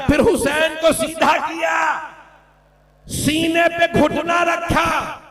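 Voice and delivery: high, slow, shouted lament; wailing lament. Acted. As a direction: climb into a grieving cry. Preacher wailing the martyrdom. (ऊँची आवाज़ में मातमी पुकार)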